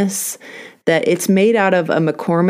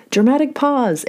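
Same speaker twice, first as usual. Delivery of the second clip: higher, playful announcement; mock dramatic; 8 semitones higher than usual. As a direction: announce it with a wink. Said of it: "dramatic pause in the podcast".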